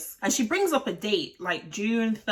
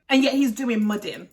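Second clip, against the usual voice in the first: ordinary talk, nothing marked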